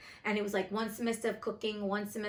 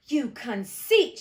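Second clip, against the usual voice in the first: louder, slow, biting sarcasm; frightful sarcasm; 7 dB louder, much slower than usual. A narrator's reading. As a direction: drag it out with scorn. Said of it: "she exclaimed with frightful sarcasm".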